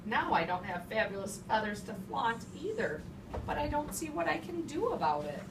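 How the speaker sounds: ordinary talk, nothing marked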